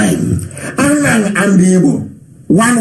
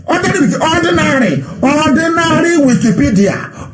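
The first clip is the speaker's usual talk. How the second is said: "ordinary" repeated higher, each one hammered; insistent repetition; 7 semitones higher than usual. Real, not acted. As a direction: drive home "ordinary" again and again, pitched up. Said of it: "I said ordinary".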